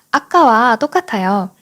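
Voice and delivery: ordinary delivery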